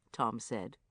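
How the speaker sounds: plain reading voice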